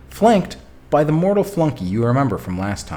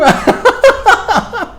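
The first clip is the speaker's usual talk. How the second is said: laughing; 9 dB louder than usual. Real, not acted. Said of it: "saying choo-choo ... gives me such joy".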